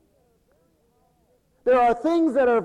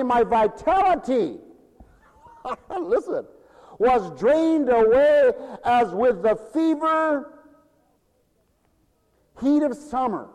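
scripture reading; 5 semitones higher, 4 dB louder than usual. Acted. Preacher reading the psalm to the congregation, declaiming.

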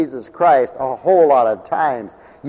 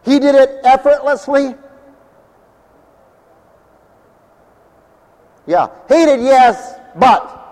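raised, louder preaching voice; 8 semitones higher, 7 dB louder than usual. Acted. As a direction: proclaim it to the congregation. Preacher building the sermon.

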